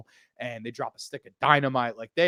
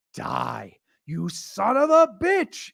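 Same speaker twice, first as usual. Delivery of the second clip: slow, high, louder defiant cry; heroine's battle cry; 11 semitones higher, 3 dB louder, much slower than usual. Retold or imitated. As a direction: hurl it at the killer. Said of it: "Ellie kinda stares him down and just goes".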